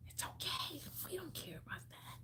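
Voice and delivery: soft whisper. Real, not acted. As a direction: whisper it gently like a secret comfort. Reassuring viewer in whisper.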